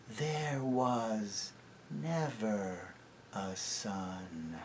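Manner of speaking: very slow, soft witch; hushed witch voice. A narrator's reading. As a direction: seal the spell quietly. Witch declares.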